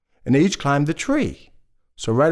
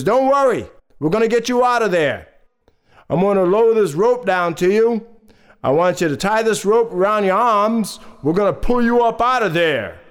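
raised, reassuring rescuer's voice; fire chief calling; 4 semitones higher than usual. Acted. Fire chief reassures the man in the well, calling down. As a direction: call down higher, taking charge. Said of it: "the fire chief says".

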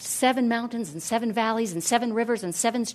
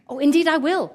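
higher, louder, hearty promise; eager agreement; 6 semitones higher, 6 dB louder than usual. A narrator's reading. Hero eagerly agrees to help.

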